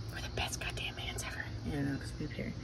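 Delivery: soft hushed whisper; breathy secret aside. Acted. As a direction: whisper it so the kids can't hear. Hushed aside between parents.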